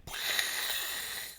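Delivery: long airy whooshing burst; imitated blast-off. Speaker imagining Earth flying off as a spaceship, imitated blast-off whoosh. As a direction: mouth a rocket whoosh.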